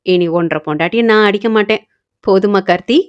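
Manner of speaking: ordinary delivery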